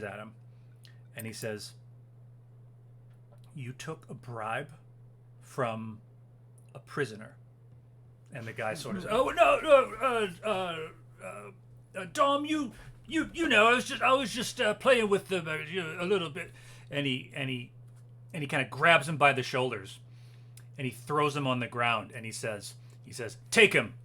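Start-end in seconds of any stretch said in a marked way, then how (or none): 3.5-7.4 slow, quiet menace with long pauses; cold accusation. Acted. Officer confronts the bribed guard, slow and menacing. 9.1-16.5 high, loud, stammering fluster; panicked excuse. Acted. Caught guard excuses himself to his superior, panicked. 23.5-23.9 loud, higher-pitched order; sharp command. Acted. Officer orders two guards to seize him, commanding shout.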